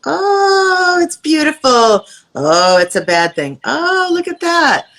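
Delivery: mock wide-eyed delight; gushing delight. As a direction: gush like everything is amazing. Acting out a framing reaction.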